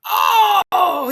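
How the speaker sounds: sudden high loud shout of alarm; shocked yell. Retold or imitated. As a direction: blurt it out like you just saw the take ruined. Engineer reacts to ruined take.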